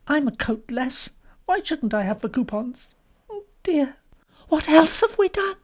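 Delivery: high-pitched flustered wife; anxious Molly. A narrator's reading.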